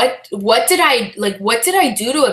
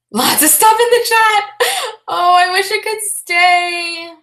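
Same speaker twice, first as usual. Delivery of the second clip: excited squeal; 10 semitones higher, 4 dB louder than usual. Real, not acted. Reading sweet chat messages live.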